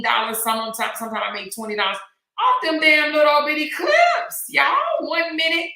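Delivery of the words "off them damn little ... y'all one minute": high, loud and slow, amazed at the money; excited. Real, not acted. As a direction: belt it out in disbelief at easy money. Bragging about clip earnings.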